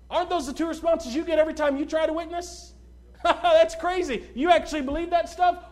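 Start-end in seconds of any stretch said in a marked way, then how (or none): 3.0-5.6 loud, high mock incredulity; mocking laughter. Scoffer answering someone's witness, imitated mocking laughter. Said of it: "Some mock".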